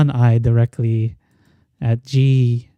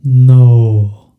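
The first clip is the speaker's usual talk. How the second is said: giant whale voice; 8 dB louder than usual. Acted. Whale refuses.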